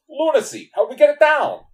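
high, loud mock Chicago accent; blunt Chicago accent. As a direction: blurt it in an exaggerated Chicago accent. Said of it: "speaks in this really blunt Chicago accent".

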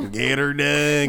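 put-on slow country-accent catchphrase; put-on, slow country twang. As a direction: draw it out in real country style. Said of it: "real country".

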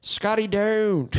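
high, drawn-out mock cry; playful call-out. Host hails the email writer Scotty, playful high call-out.

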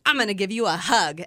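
loud, eager announcement; imitating an excited coworker. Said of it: "she's real excited about something".

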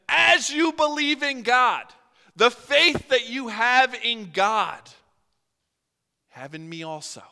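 Jesus boldly claiming equality with God; emphatic proclamation. Acted. Jesus's bold claim to apostles.

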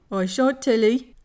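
put-on broad West Country accent, emphatic. A narrator's reading. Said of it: "in a broad West Country accent".

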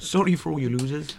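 high, soft mock Canadian; Canadian accent. Co-host consoling the award losers, high mock Canadian accent. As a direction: apologise in a put-on Canadian accent. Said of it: "my accent's amazing".

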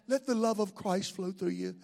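low, soft hippie voice; mellow hippie. Acted. Preacher mocking how people talk about Christ's love, mellow hippie voice. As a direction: imitate a laid-back hippie preaching love, quietly. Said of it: "I think hippie love".

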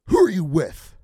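loud angry challenge; mad. Retold or imitated. Sergeant major confronting capped Rangers, loud and angry. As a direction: bark it at him like an offended sergeant major. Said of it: "Like, all mad".

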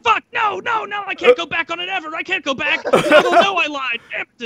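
high, frantic imitation of a panicked drunk guest; mock panic. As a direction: squeal the denials like he's caught in a lie.

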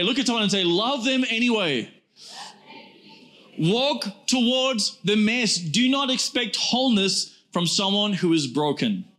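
high, driving preacher delivery; full-force preaching. Acted. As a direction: preach it hard to the congregation.

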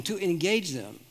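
normal talking voice

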